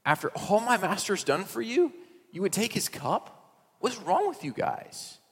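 high, slow, incredulous accusation; accusing servant. Retold or imitated. Servant accusing the brothers of taking the cup, high and slow. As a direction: scold them for stealing the cup. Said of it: "he gets to them and he says".

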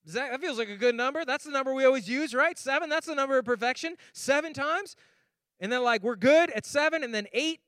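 high and loud eager Peter bargaining; excited bargaining. Retold or imitated. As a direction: sell seven times like a great deal.

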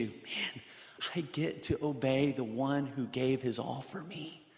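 hushed, soft, awed gratitude; grateful wonder. Retold or imitated. Believer marveling at getting to obey, hushed and grateful. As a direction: breathe it out in thankful awe. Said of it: "It's a get to".

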